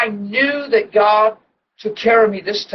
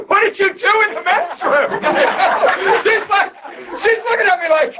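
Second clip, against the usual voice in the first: laughing; 12 semitones higher than usual. Retold or imitated. Laughing while voicing his wife.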